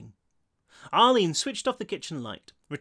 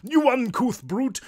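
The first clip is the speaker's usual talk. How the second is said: loud, high, slow theatrical insult; booming English ghost; 9 semitones higher, 5 dB louder, slower than usual. A narrator's reading. Bald ghost insulting his companion, booming English. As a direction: scold haughtily in a grand English accent. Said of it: "a deep, booming tenor with what might have been an English accent".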